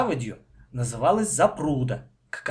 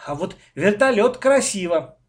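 louder, higher counter-claim; arguing; 6 semitones higher, 6 dB louder than usual. A narrator's reading. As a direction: push your own choice in a squabble. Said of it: "стал спорить мой брат".

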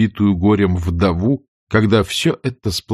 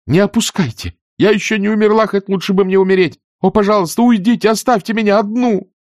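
higher, desperate and angry; angry tearful outburst; 12 semitones higher, 4 dB louder than usual. Acted. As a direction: drive them out of the room. Scarlett shouts from pillow. (отчаянно и зло)

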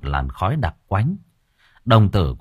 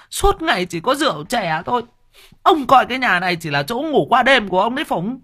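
shrill nagging wife. Acted. Wife shrilly berates her husband, very high and fast. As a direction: nag him shrill and fast. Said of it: "càng thêm choa choa".

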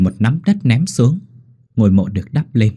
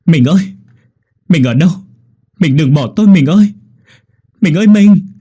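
higher, much louder anguished yelling; desperate shout; 8 semitones higher, 8 dB louder than usual. Acted. Widower shouting for his vanished wife's ghost, anguished. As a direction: scream for her with the last of your breath. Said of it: "hét lên".

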